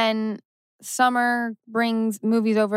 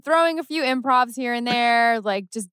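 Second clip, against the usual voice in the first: usual speaking voice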